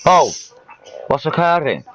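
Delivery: high, exclaimed Welsh catchphrase; comic Welsh lilt, pitched right up; Welsh accent. Pretend. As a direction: greet them in hammed-up Welsh. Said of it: "when you do that Welsh accent".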